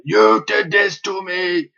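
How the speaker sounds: high, drawn-out imitated scream, badly faked French accent; mock scream. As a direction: wail it slowly in a hammy French accent. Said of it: "screaming and shouting at".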